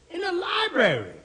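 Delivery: LIBRARY pitched very high, then dropping; incredulous repeat. Acted. Repeats it in disbelief.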